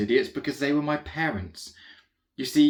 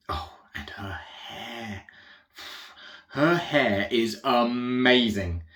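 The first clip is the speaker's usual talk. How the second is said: slow awed gush; much slower than usual. Acted. Swooning over the queen's hair.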